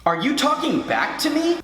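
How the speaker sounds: high-pitched mock scolding; affronted teacher. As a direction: imitate an offended teacher. Teacher scolds pupil, acted.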